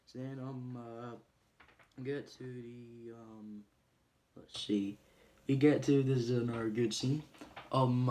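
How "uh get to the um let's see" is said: usual speaking voice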